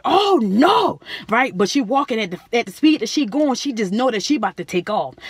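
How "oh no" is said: angry outcry. Retold or imitated. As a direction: shout it in outrage. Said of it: "'Cause she was like".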